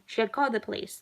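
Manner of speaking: usual speaking voice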